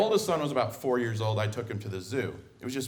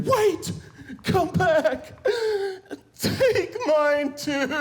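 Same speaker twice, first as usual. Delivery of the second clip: much higher, louder pleading scream through laughter; 6 dB louder, much slower than usual. Retold or imitated. Tired mother chasing dad with stroller, laughing scream. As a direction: beg desperately, half laughing. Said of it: "she's screaming".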